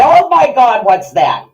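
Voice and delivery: a jolted, freaked-out father; startled dad. Retold or imitated. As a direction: ham up a spooked father. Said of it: "Dad was the one that used to get".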